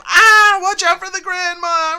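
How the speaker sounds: very high, loud panicked squeal; silly clown. Acted. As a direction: shriek it like a cartoon panic. Goofy panic skit.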